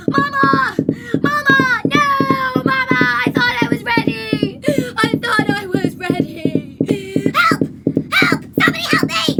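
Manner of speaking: high, loud panicked wails for mama; mock screaming. Tom screaming for his mama mid trip, hammed-up shriek. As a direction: shriek it like a terrified child. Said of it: "He's shouting. He's screaming".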